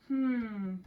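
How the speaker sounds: normal talking voice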